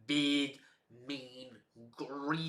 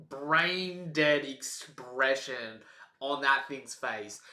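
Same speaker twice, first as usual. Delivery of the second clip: usual speaking voice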